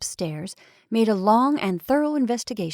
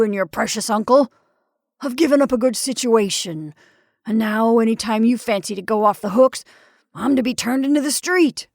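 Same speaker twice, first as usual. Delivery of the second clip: indignant tirade; 6 dB louder than usual. Acted.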